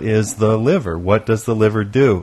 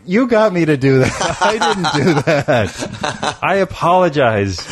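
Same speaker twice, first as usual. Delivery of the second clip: higher, protesting through laughter; laughing, drawn-out denial; 5 semitones higher, slower than usual. Real, not acted. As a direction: deny it while cracking up. Laughing protest after insensitive joke.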